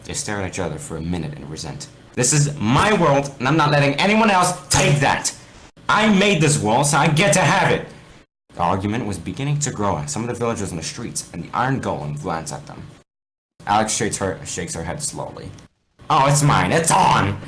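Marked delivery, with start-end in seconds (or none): 2.1-7.9 high, loud, stubborn claim; heated argument. Acted. Steve claiming the world from Alex, heated. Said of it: "quarrel escalates". 16.1-17.4 high, loud defiant challenge. Acted. Alex defying Steve, loud and defiant. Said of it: "She stamps her foot".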